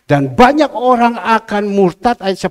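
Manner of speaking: loud, high, forceful Bible proclamation; preached scripture reading. Acted. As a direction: belt the verse out to the congregation. Reads scripture at full force.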